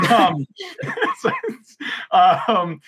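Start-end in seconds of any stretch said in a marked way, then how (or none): 0.5-1.1 high laugh. Real, not acted. Still laughing.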